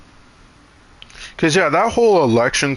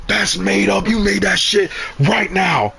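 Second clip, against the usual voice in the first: a raised, higher mock-outraged retort; imitating angry voter; 8 semitones higher than usual. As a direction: play the voter snapping back in disbelief.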